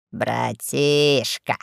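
low, very slow drawl; deep, stretched-out lordly greeting; lazy boss. A narrator's reading. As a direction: drawl it heavily to a little brother. Fat boss greets younger brother.

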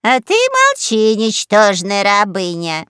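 loud, slow scornful yell; scornful, stretched-out silencing cry; angry shout. A narrator's reading. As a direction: shout her down with drawn-out contempt. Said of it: "закричала на неё боевая подруга".

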